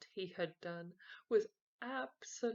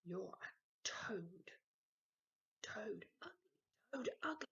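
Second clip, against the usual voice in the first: hissed teasing whisper; whispered taunt; 2 semitones higher than usual. Acted. Peter teases brother secretly, whispered taunt. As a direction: taunt him under your breath.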